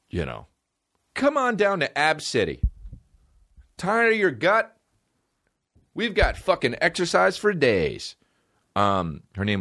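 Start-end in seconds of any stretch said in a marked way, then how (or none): 1.1-8.2 announcer hyping a fake gym; mock infomercial. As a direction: sell it like a cheesy gym commercial. Mock ad for Ab City.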